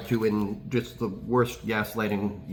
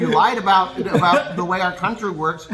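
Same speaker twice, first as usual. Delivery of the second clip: mocking, higher and louder, laughing; sarcastic rant; 6 semitones higher, 5 dB louder than usual. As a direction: sneer at him, laughing.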